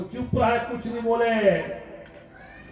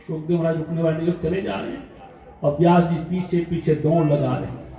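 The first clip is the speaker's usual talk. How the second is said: ordinary talk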